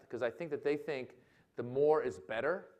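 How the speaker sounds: ordinary delivery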